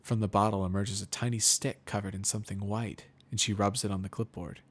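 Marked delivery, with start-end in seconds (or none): none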